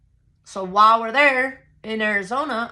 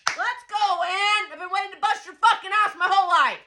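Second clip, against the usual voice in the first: high, fast fighting hype; hyped-up challenge; 12 semitones higher than usual. Retold or imitated. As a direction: crow it like you're raring to fight.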